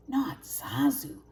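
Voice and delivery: slow whispered protest; hushed grumble under the breath; muttered thought. A narrator's reading. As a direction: whisper it to yourself, fed up. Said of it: "thought Simba".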